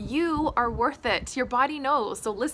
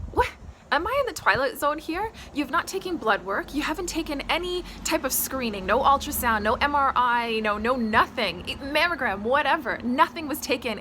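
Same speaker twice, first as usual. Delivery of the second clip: higher, incredulous retold outburst; indignant disbelief; 3 semitones higher than usual. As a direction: fire off the list in mounting outrage. Retells reacting to shock diagnosis.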